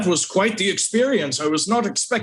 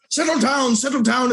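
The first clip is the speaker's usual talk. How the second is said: calling out; 8 semitones higher than usual. Acted. Calming feral retainers.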